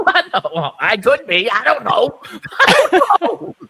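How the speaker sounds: rising giggly laugh. Real, not acted. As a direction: laugh through the words. Laughs through answer.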